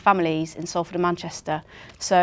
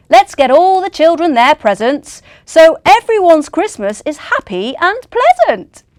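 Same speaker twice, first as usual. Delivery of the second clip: Santa urging; 8 semitones higher, 11 dB louder than usual. Acted. Santa urges team.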